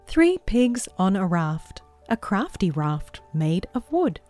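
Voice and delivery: ordinary delivery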